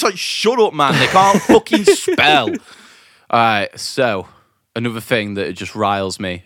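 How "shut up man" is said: ordinary talk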